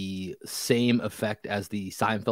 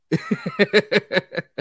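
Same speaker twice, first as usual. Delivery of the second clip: high burst of laughter; 9 semitones higher, 5 dB louder than usual. Real, not acted. Reacting to betrothed joke.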